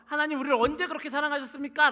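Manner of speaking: confrontational challenge. Acted. Israelites challenging God over his love, confrontational. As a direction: throw the question up as a loud accusation. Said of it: "따지듯이 이야기하는".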